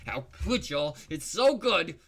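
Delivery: loud, high mock gushing; sarcastic. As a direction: overdo the praise sarcastically. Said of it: "Just kidding".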